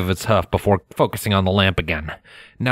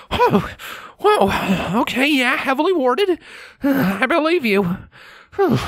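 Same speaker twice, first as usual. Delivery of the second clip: lightly voiced young stallion, shaken and rattled; a hint of a startled pony catching his breath; dazed stallion. Stallion rattled by the lamp's wards, lightly shaken. Stallion reacts after magic shock.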